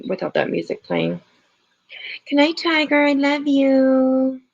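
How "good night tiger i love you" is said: affectionate goodnight. Real, not acted. Streamer saying good night to Tiger, affectionate and drawn-out, fading away.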